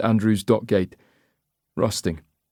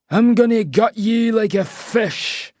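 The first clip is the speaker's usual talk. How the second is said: slow, high Scottish threat; menacing Scottish accent; 12 semitones higher, slower than usual. A narrator's reading. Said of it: "he says in a Scottish accent".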